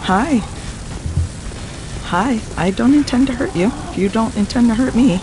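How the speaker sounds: high, loud, sing-song friendly voice; sweet talking. Acted. Player sweet-talking a wild creature to calm it, high friendly voice. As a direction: coax the creature like a pet. Greeting a wary game creature.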